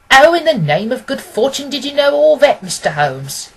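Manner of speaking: high, loud burst of amazement; he can't believe what he's heard; astonished exclamation. Acted. Client startled by Holmes's deductions, astonished. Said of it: "started up in his chair".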